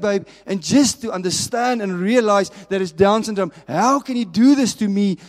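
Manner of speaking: a father's broken, loud protest; anguished shout at God. Retold or imitated. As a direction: cry out at God in betrayed anguish. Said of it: "he started screaming, screaming, and crying".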